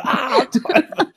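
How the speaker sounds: high, slow laughing moan of pain; pained groan. Retold or imitated. Voicing men clutching backsides.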